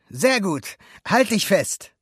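high, loud, eager instruction; rally the passenger before takeoff; eager approval. A narrator's reading. Alberto readies Luca for the ride, eager.

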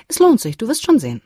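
mother reassuring her sulky son; bright reassurance. A narrator's reading. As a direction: sell it with cheer. Mother promises it's worth it.

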